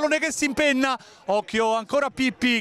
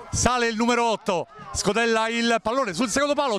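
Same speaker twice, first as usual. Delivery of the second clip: ordinary talk, nothing marked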